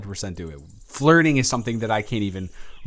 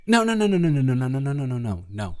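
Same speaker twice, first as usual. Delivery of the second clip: frantic refusal; 3 semitones higher, faster than usual. Real, not acted. Horrified refusal of frat party idea.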